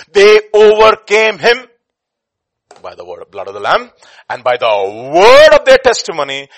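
loud, emphatic scripture proclamation; full-force preaching. Real, not acted. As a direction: thunder out the verse.

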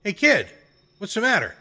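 put-on high-pitched call down to a kid; yelled call. A narrator's reading. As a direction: call out high from the window, without much force. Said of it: "I yelled".

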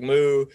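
a loud, hammed-up cow moo; mock cow moo. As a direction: belt out a silly moo like a prankster. Imitating the mooing outside.

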